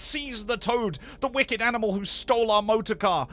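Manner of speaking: loud, high, furious shouting; rally them to seize him; angry cries. A narrator's reading. Passengers turning on Toad, shouting in loud fury. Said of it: "they cried".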